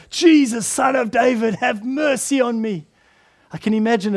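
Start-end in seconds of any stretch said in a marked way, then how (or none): 0.1-2.9 crying out. Acted. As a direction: beg for mercy at full voice. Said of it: "he cries out all the more".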